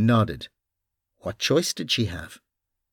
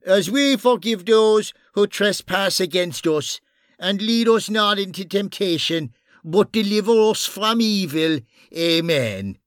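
pious fake prayer, raised; praying aloud; 8 semitones higher than usual. Bunny fakes a prayer.

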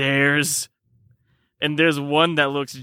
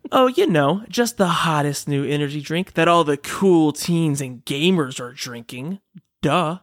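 ad read; 3 semitones lower than usual. Acted. Reads a sponsor ad.

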